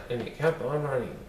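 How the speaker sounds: ordinary delivery, nothing marked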